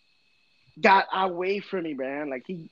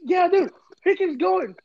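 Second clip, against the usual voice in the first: excitedly retelling, pitched up; 11 semitones higher, 3 dB louder than usual.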